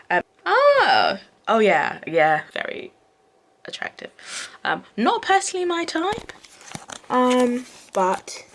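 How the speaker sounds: admiring, slightly plummy swoon over the actor; posh voice. Real, not acted. Vlogger admiring Aragorn's actor in a posh voice. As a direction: go suddenly posh while gushing. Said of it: "my voice went really posh there".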